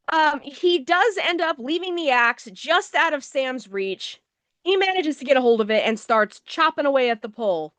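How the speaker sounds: ordinary talk, nothing marked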